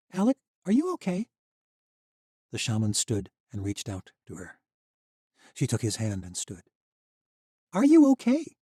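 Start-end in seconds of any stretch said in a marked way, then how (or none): 0.1-1.3 worried. A narrator's reading. Misha worried. 7.7-8.6 worried. A narrator's reading. Misha asks again, louder.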